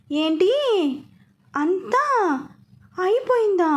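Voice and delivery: high, stunned disbelieving question; reeling in shock; young woman, voice climbing in shock. A narrator's reading. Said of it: "అంది షాక్లోనే".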